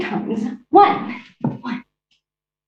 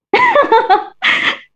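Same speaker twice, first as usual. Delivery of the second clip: high theatrical diva laugh; high villain laugh. Acted. Cruella laughing at her own bag joke, high and theatrical.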